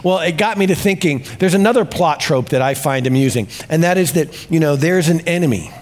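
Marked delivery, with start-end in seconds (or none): none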